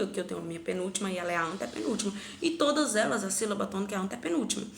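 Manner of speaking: ordinary delivery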